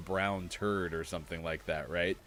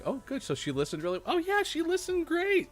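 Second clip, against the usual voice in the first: high, overly sweet teacher praise; gushing teacher; 3 dB louder than usual. Retold or imitated. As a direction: lay on the fake enthusiasm. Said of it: "and it was like".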